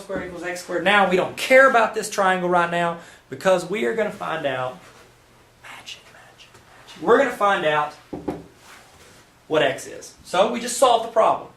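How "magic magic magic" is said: breathy hushed magic spell; mock magic whisper. Magic moment in math lesson.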